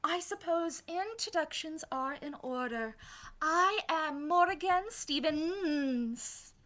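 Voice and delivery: high, lilting vampire lady, slowing at the end; lightly voiced gracious hostess; Morrigan.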